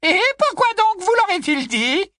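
put-on high, loud squeak from a tipsy old aunt; high loud old aunt squeaking at Harry. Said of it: "couina Muriel".

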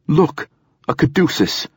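sudden, high call of discovery; surprised exclaim. A narrator's reading. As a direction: point it out, startled. Android spots the hospital symbol.

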